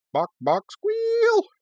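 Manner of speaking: chicken clucks ending in a very high squeal; taunting clucks, then a long piggy squeal; mock animal noises. A narrator's reading. Korra taunting Tenzin as pig chicken, mock squealing.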